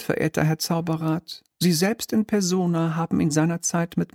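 high, mannered little devil voice; a smug minor demon, lightly shrill and polite; oily demon clerk. Acted. Demon presents the contract.

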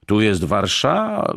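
roar cut short. A narrator's reading. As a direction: start a drawn-out roar, then break off. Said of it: "chciał ryknąć pan Franciszek". (urwany, przeciągły ryk)

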